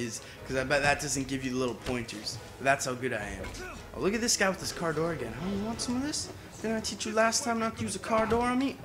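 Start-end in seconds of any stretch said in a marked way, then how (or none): 5.4-8.8 taunting. Acted. Taunting an enemy in a fight.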